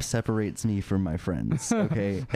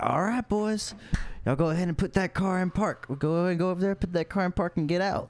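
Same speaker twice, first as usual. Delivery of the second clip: mock cop voice pitched much higher; imitating the cop; 9 semitones higher than usual. Cop ordering stoned friends out of the car, in a high mock voice. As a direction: play the officer giving orders, pitched up high. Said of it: "the cops were like".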